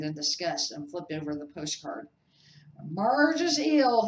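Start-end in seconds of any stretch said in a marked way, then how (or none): 2.8-4.1 slow, loud, higher announcement. A narrator's reading. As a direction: draw it out heavily. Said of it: "he informed Aunt Petunia".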